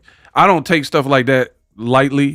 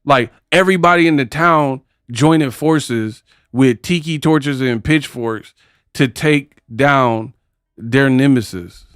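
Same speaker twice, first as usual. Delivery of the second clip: ordinary talk, nothing marked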